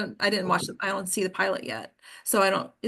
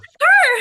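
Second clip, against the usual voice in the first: delighted laugh; 13 dB louder than usual. Real, not acted. Laughing at own story.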